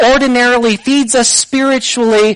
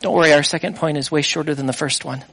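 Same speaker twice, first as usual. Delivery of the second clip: quick joking aside; 7 semitones lower, 7 dB quieter, faster than usual. Real, not acted.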